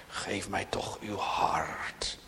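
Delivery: slow pleading whisper; a soft fatherly plea; whispered slow entreaty; tender whisper. Real, not acted.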